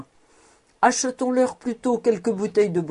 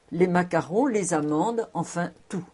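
plain reading voice

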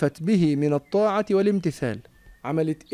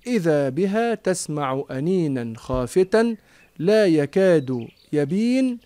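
usual speaking voice

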